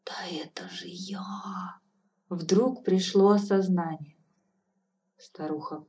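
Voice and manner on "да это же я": slow, breathless whisper; sudden realization, hushed whisper; awed whisper. Acted. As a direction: whisper it in stunned recognition.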